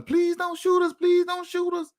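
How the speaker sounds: high mock pleading child voice; high children's plea not to be shot; kids' frightened plea. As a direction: imitate the scared kids, high-pitched. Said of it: "they hollering".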